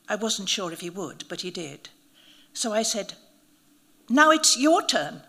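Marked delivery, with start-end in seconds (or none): 4.1-5.2 louder, higher challenge; daring challenge. A narrator's reading. Narrator challenging the priest to touch the snake, loud. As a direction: push him to take his turn.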